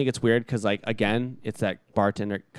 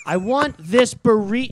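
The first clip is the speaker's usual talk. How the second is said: high, loud and slow, word by word; talking down to an idiot; 10 semitones higher, 7 dB louder, slower than usual. Retold or imitated. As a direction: imitate a customer spelling it out condescendingly. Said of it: "like, I'm an idiot".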